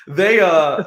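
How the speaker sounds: normal talking voice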